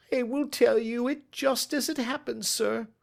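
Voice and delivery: high, strained confession voice; Ryder's nervous voice. Acted. Broken thief confessing to the detective, strained and high.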